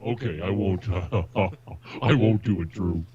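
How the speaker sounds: ordinary talk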